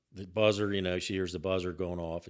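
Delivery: ordinary delivery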